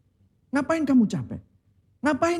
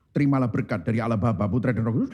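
low, quick liturgical drone; playfully intoned blessing, pitched way down; mock benediction; 11 semitones lower, faster than usual. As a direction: rattle off the blessing in a low pastor's voice. Acts out pastor giving the blessing.

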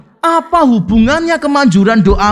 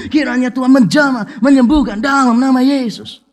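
higher, unbroken stream of lofty prayer; grandiose pastor's prayer; 3 semitones higher than usual. Acted. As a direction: imitate a pastor praying ornately, climbing to the final name. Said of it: "Yang kedua".

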